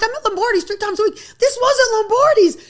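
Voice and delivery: high and loud, last line stretched out; indignant yell. Retold or imitated. Answering TikTok commenters, indignant mock shout. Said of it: "I'm like".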